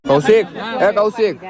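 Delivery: loud, high call across the crowd. Real, not acted. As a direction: shout his name to get his attention. Calling out to Kaushik across crowd.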